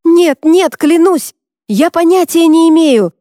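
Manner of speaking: high panicked shouting; desperate scream. Acted. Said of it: "закричал он". (отчаянный крик)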